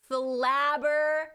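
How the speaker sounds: very slow, every syllable of flabber fucking gasted stretched; drawn-out emphasis. Acted. Streamer to chat, dragging out her shock very slowly. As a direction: stretch it out for comic effect.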